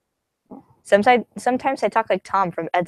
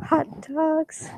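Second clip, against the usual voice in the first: put-on hot-dog yell; 9 semitones higher, 4 dB quieter than usual. Imitating the hot dogs yell for viewers, high put-on voice. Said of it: "that voice when I yell".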